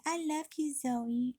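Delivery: high, sugary mock of mom; mock sweet mom. Girl imitating mom cooing at the dog in mock sweetness. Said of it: "she's like".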